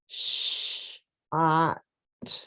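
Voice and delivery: Sh whispered, then ah, then T; sounding out. Shown as a demonstration. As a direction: split shot into its sounds. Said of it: "Little tricky".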